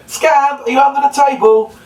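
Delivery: high, loud call muffled behind cupped hands; muffled through hands. Shown as a demonstration. Children's entertainer calls for the hidden puppet dog, high and muffled. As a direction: call out as if through a table, hands over mouth. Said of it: "your voice would be a bit more muffled".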